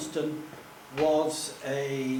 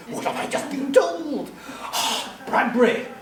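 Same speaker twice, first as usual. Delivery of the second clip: excited; 9 semitones higher, 5 dB louder than usual. Retold or imitated. Retold thrilled thought.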